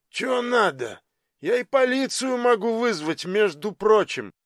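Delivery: high-pitched, grumbling little fellow; sleepy little man. A narrator's reading. Woken man threatens visitors with police, grumbling. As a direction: pitch it well up, a put-out householder. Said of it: "широко зевнул заспанный мужичок".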